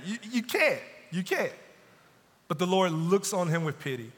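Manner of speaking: usual speaking voice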